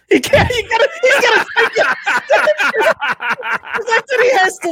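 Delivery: high, loud laughing outburst; laughing shout. Real, not acted. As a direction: crack up while yelling it out. Laughing at friend's struggle.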